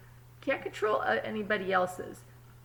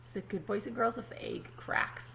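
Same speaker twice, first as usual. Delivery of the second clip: whispered question; 6 dB quieter, faster than usual. Real, not acted. Teacher whispering a question to the children. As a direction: whisper if the egg cracks.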